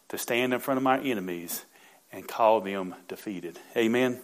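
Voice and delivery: hushed earnest prayer voice; quiet fervent preacher's prayer. Acted. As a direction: pray it to the Lord, reverent and intimate. Prays aloud mid-sermon.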